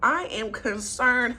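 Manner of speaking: ordinary talk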